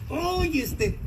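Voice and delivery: exasperated parent sigh. Retold or imitated.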